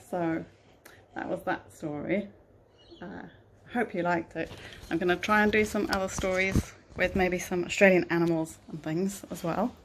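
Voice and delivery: plain reading voice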